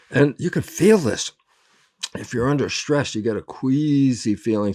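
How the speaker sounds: normal talking voice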